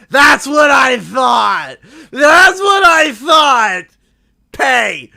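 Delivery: loud, slow gloating; triumphant shout. Retold or imitated. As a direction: crow it at the driver who finally pays. Triumphant at paying driver.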